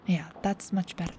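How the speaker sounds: ordinary delivery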